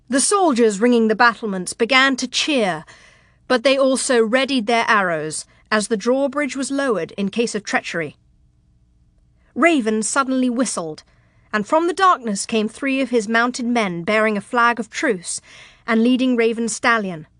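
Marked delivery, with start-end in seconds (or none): none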